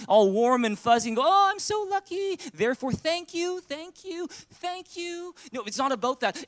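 ordinary talk, nothing marked

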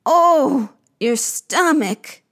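slow moan, starting high; echoed moan. Acted. Creatures echoing the queen's bellyache, moaning slowly. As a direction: moan back in drawn-out sympathy. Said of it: "They moan".

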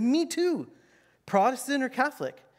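high, eager and chummy; a hammed-up overeager believer; eager me too. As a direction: jump in, keen to agree. Eager narrator in the joke.

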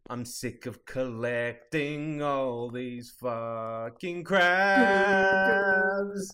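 slow, then yelled crabs; mock frustrated yell. Mock rant about crabs.

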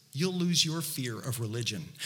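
usual speaking voice

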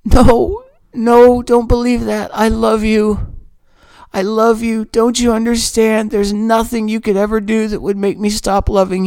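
an earnest, emotional plea of love; desperate reassurance. Retold or imitated. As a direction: hold her face and make her believe it. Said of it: "I said".

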